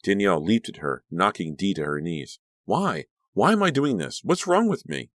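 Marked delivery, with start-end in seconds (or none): 2.7-5.1 high, frightened questions; rising panic, climbing higher; panicked. Acted. Danielle horrified at her own violence, high and panicked.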